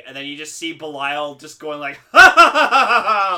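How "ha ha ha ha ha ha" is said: loud, high mock cackle; imitated evil laugh. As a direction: laugh it out like a gloating monster. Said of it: "literally laughs".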